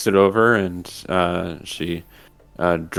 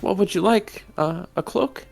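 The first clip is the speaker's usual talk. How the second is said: pixie; 11 semitones higher, 4 dB quieter than usual. Acted. Shopkeeper voice.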